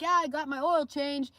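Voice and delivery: hammed-up show-off; mock bragging. Mocking David's boast.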